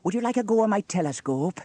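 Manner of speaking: high, loud, angelic-sounding trickster; mischievous boy. A narrator's reading. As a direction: offer it eagerly with hidden mischief. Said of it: "asked Norman mischievously".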